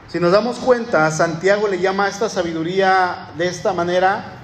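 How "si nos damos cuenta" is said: ordinary delivery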